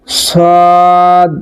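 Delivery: সদ with the vowel held long; heavy, sustained সদ; drawn-out sad. Shown as a demonstration. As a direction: stretch সদ for four counts. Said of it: "চার আলিফ পরিমাণ টেনে পড়তে হবে".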